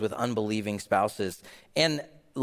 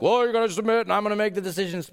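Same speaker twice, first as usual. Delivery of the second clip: mock domineering husband, fast, higher and louder; mocking bossy husband; 8 semitones higher, 4 dB louder, much faster than usual. Pastor mocking a controlling husband's demand, higher and louder. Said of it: "What a bunch of garbage".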